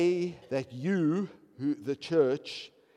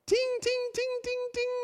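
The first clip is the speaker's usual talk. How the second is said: much higher, chiming ting ting; bell ringing; 5 dB louder than usual. Retold or imitated. Preacher making a bell ring in their heads, chiming. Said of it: "The bell's going off in your head".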